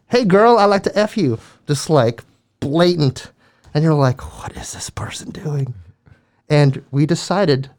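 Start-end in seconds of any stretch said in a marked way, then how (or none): 0.1-1.4 loud, higher-pitched mock catcall; belligerent catcall. Retelling a man shouting at girls walking, belligerent catcall. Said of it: "He was very belligerent". 4.4-5.7 hushed, whispered aside; whispered disbelief. Retold or imitated. Students reacting to the man's blatant catcall, whispered disbelief.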